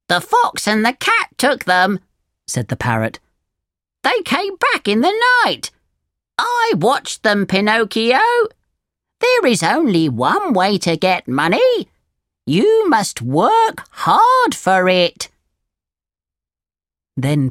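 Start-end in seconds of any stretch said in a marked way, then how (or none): 0.1-2.0 high bird character; storybook parrot breaking bad news. A narrator's reading. Parrot reveals the theft. 4.0-15.3 high, swooping bird voice; storybook parrot telling someone off; scolding parrot. A narrator's reading.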